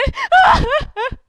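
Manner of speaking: loud, high mock sobs; hammed-up crying; acted sobbing. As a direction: burst into stagey wailing sobs. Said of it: "dia nangis".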